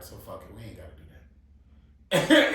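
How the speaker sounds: ordinary delivery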